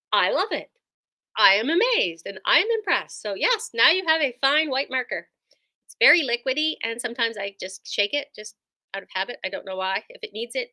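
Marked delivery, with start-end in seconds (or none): none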